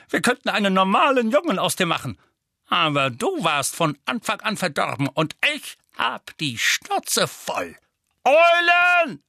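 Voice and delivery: hoch und laut, schimpfender Vernon; brüll den Jungen wütend an; wütender Onkel Vernon. Acted. Vernon tobt weiter, schreit Eulen. (Onkel Vernon wirft Harry schimpfend raus)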